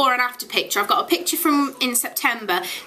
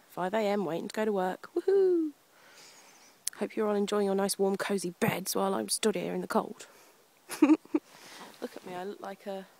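quiet early morning voice; 2 semitones lower, 11 dB quieter than usual. Real, not acted. Quiet early-morning vlog, cold.

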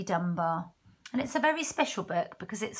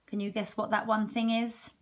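plain reading voice

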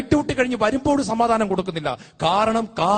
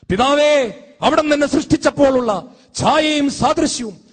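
high, loud appeal to the Father; crying out to God; 5 semitones higher, 5 dB louder than usual. Acted. Preacher crying to the Father over lost likeness, raised appeal. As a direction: call out in raised, urgent prayer.

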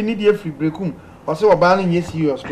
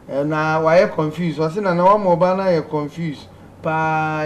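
normal talking voice

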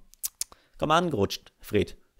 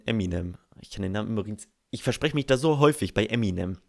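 usual speaking voice

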